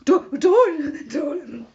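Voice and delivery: startled, high and loud pleading; genuinely spooked, voice jumping up in alarm; real fright. Real, not acted. Streamer spooked by a viewer's look-behind-you remark, frightened. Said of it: "I literally crapped myself".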